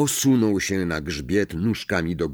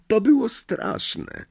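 much higher, slow, horrified voice; dreadful memory; 13 semitones higher, slower than usual. A narrator's reading. Neville recalls his grandmother's Howler, horrified. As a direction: stretch it out in dread.

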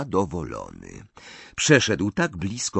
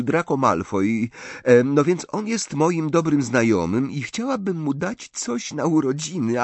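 higher, bright, chatty schoolgirl voice; girl playing innocent; 6 semitones higher than usual. Hermione fishing for information from Bordzin. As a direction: babble sweetly to fish for information.

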